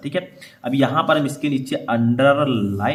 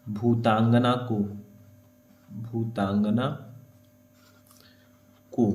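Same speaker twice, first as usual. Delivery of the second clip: slow, lower, word-by-word; writing-pace dictation; 4 semitones lower, 6 dB quieter, slower than usual. Real, not acted. Teacher writing the last words of the verse, slow dictation. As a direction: say it slowly as you write.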